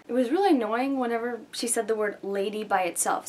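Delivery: ordinary talk, nothing marked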